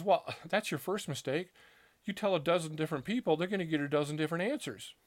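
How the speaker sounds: a hint of a priest setting him straight; priest cutting in. Priest interrupts the storyteller, a mild quick rebuke.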